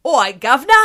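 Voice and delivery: loud, hammed-up faux Cockney greeting; mock Cockney accent. Mocking the show's faux British accents, hammy Cockney. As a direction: send up a fake British accent. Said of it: "what range of faux British accents".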